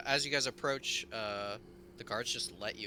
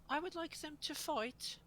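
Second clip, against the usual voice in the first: put-on much higher, small child king giving an order; a tiny boy king making a demand; 5 dB quieter than usual. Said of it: "and he goes".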